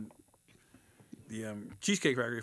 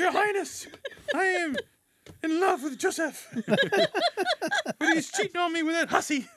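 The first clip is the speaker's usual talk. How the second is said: high, melodramatic courtroom confession; dramatic actress voice; 4 dB louder than usual. Retold or imitated. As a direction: plead like a wronged damsel. Actress repeat.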